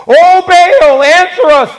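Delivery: high, loud cry out to a god; shouting. Acted. Prophets of Baal calling on their god, shouting. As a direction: call out pleadingly, pitched well up. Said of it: "they shouted".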